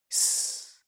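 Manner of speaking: soft, voiceless hiss of warning; warning shush. A narrator's reading. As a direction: hush the listener sharply without voice. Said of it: "با صدایی هشدار دهنده گفت". (هیسِ هشداردهنده)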